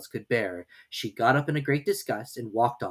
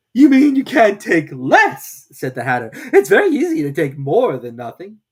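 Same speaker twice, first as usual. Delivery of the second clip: higher, louder, mocking Hatter; smug Hatter; 6 semitones higher, 11 dB louder than usual. A narrator's reading. Hatter correcting Alice at tea, smug and loud. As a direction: lord it over her, loud and pleased. Hatter voiced in read story.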